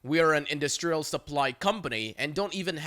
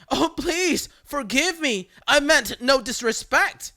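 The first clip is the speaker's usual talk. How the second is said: high, whiny fake sobbing plea; hammed-up fake crying to the caller; 8 semitones higher, 4 dB louder than usual. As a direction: ham up a begging victim. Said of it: "still fake crying".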